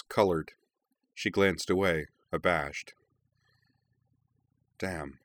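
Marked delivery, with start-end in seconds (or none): 4.8-5.2 soft, rueful curse; soft embarrassed damn; softly. A narrator's reading. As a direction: let it slip out under the breath. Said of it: "she said softly".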